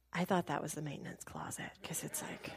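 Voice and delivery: whispered confession. Real, not acted. [name] admitting his mistake, whispered. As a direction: breathe it under your voice. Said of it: "whispered aside".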